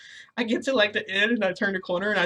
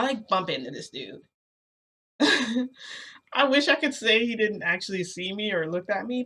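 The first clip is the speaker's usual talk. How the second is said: usual speaking voice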